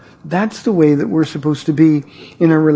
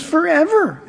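put-on much higher, laughing kid voice; little boy laughing; 10 semitones higher than usual. Pastor voicing little boy.

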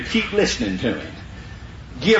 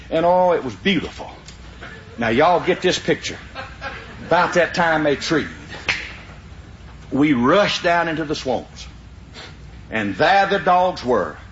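normal talking voice

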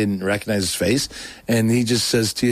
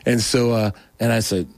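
ordinary talk

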